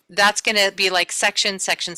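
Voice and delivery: ordinary delivery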